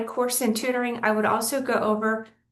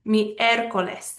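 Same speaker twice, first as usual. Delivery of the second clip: slow MIÉR-co-les, first syllable stressed; stress on third-to-last syllable; slower than usual. Shown as a demonstration. Teacher showing Wednesday, Miércoles stressed on MIÉR. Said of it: "That's the third to last syllable".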